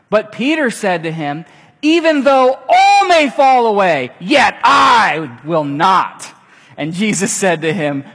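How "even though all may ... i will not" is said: loud high shout, slowing on yet I will not; insistent shout. Acted. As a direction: bellow the vow, dragging the last words. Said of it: "kept in saying insistently".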